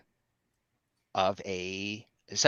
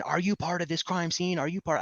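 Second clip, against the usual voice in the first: half-hearted holler with raised pitch; retold hollering; 9 semitones higher than usual. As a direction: imitate an officer hollering questions.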